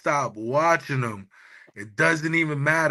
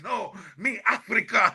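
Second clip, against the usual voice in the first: put-on Panamanian accent; 6 semitones higher than usual. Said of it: "he sounds mad Panamanian".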